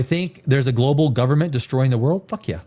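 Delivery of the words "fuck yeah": ordinary talk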